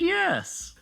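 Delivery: high, soft joking reply; self-mocking aside; mock answer. Answers own question, mock voice.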